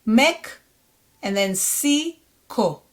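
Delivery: ordinary talk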